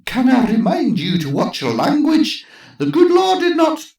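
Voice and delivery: prim Scottish church lady; Scottish accent. Acted. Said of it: "with a strong Scottish accent".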